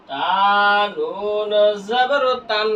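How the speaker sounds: very slow, with নুন held long; slow model spelling for learners to follow; drawn-out spelling recitation. Shown as a demonstration. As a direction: stretch each letter name into the next. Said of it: "বানান করলাম".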